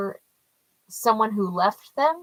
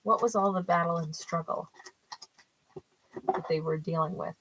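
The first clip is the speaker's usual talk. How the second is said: softer, measured restatement of the question; quiet, careful second ask; calmer, quieter retry; 2 semitones lower, 6 dB quieter than usual. Real, not acted. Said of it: "Let me just ask real quick".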